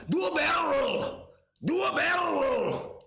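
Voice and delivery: a wingman yelling over the radio; shouted command. Pretend. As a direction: yell it like a pilot giving orders. Game quote shouted.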